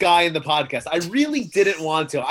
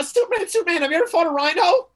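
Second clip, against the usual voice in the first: high-pitched mock pestering voice; put-on high, eager questioner; eager fan's question; 11 semitones higher than usual. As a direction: pipe up high, as if pestering a hero. Acted excited question to Superman.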